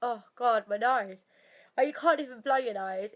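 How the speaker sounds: usual speaking voice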